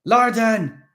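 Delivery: loud high shout. A narrator's reading. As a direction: call out urgently. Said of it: "Vansha shouted".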